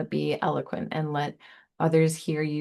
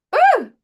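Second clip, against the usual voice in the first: high woo; 11 dB louder than usual. Real, not acted. Exclamation burst.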